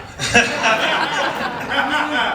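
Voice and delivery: a bright, high burst of real laughter. Real, not acted. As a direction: laugh out high, caught off guard. Laughs at listener's misreading.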